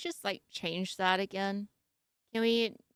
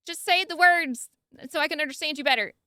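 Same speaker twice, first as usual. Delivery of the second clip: high, loud scold at the writer; mock scolding; 5 semitones higher, 7 dB louder than usual. Real, not acted. Scolding the author for using big words, loud and high. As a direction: tell the author off, half laughing.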